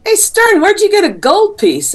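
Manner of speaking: loud call. Acted. Shirley calls loudly to Stern about his gold piece. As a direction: call out to him across the way.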